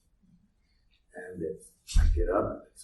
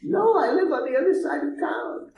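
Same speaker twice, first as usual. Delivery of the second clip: much higher tearful woman's voice. Retold or imitated. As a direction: answer as the crying woman. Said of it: "I said to her".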